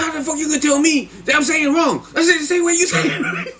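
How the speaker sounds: high-pitched indignant imitation, laughing; mad cellmate. Cellmate mad at being corrected, high mock outrage. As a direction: protest very high, cracking up by the end. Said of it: "he's get all mad".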